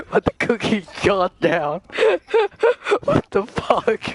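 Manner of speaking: high-pitched giggles breaking through the words; cracking up so hard the words barely come out; helpless laughter. Real, not acted. Laughing helplessly at his friend's ridiculous antics.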